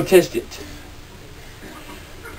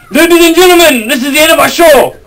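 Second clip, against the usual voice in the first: show announcer; 5 semitones higher, faster than usual. Acted. Closing the pretend show.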